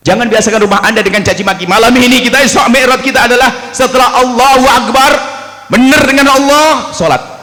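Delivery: high, loud exhortation; preacher urging the congregation; fiery preaching. Acted. Preaching at full force. (ceramah lantang dan tinggi)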